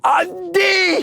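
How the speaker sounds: high laughing cry of the grade; laughing shout. Real, not acted. Laughing out his PE grade to friends.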